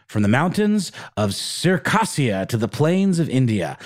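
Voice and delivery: normal talking voice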